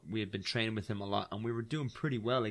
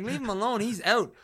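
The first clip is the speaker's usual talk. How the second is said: high, raised retold plea; begging his friend to leave the man, raised; urgent plea; 9 semitones higher, 4 dB louder than usual. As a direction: call him off, loud and high. Pleading friend to stop.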